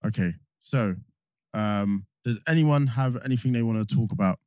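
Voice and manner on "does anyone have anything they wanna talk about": normal talking voice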